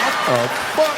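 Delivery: high, startled what the fuck; shocked. Retold or imitated. Husband shocked his phone opened so easily. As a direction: caught off guard again.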